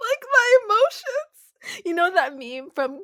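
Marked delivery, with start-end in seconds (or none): 0.1-1.8 high, slow, whiny wail about emotions; melodramatic whine. Acted. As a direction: overdo the heartbreak like a meme. Melodramatic reaction to scene.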